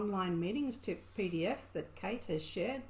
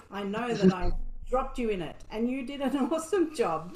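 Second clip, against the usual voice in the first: laughing; 7 dB louder than usual. Real, not acted. Praising presenter, laughing warmly.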